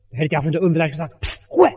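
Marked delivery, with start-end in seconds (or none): 1.2-1.7 mock shush. Zachäus shushes the pastor from above.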